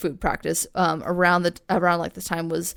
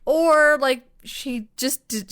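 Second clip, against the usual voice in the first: high-pitched imitated guess; mock excuse-making; 7 semitones higher than usual. Host voicing a guess about the baby, mock excuse-making. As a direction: float a flimsy theory in a high voice.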